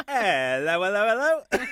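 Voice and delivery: hammed-up British singsong greeting, loud and rising; Mary Poppins. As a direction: swoop upward in a mock British accent.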